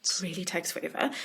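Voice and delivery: breathy whisper, dropping very soft; whispered aside. Real, not acted. Author whispering to host about the endless redraws. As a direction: let it slip out as a weary whisper. Moaning about slow cover work.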